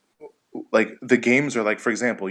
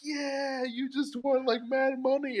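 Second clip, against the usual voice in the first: high falsetto mock cheer, held back in volume; hammed-up celebration, softly squealed; cheering crowd; 3 dB quieter, slower than usual. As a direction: imitate a thrilled crowd in a small high voice. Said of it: "And everyone's like".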